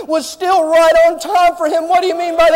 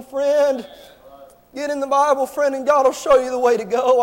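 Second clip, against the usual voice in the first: ordinary delivery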